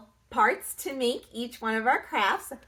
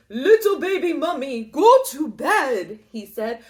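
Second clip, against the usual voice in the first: vampire voice; 7 dB louder than usual. A narrator's reading. Drac character voice.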